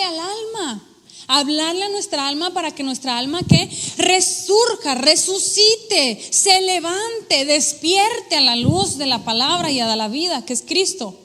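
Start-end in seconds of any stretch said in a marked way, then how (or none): 4.0-7.5 slow, with each word stressed and weighty; slow emphatic commands. Acted. Preacher urging the soul to rise, slow and emphatic. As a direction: lean on every verb, slowly.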